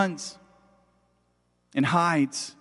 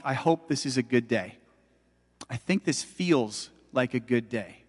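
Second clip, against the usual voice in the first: hushed hopeful thought; 4 semitones lower, 7 dB quieter than usual. Retold or imitated. Retold hopeful morning thought.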